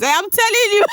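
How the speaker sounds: high voice then a laugh; high laughing warning. Real, not acted. Laughs at apology.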